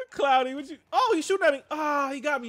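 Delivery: high, loud panicked calls; being chased. Real, not acted. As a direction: yell in alarm while under fire. Enemy shooting at him in game.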